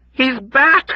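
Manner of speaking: high, loud panicked scream; shrill cry of horror; terrified scream. Acted. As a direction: scream it in terror. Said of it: "Dunbar screamed again".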